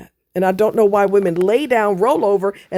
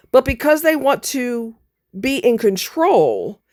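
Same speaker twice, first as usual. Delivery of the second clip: angry rant; 6 semitones higher than usual. Real, not acted. Angry rant about lawmakers.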